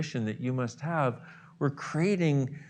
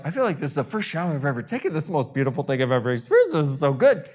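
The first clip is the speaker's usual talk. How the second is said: excited gush; 6 dB louder, faster than usual. Pretend. Gushing about first real shower.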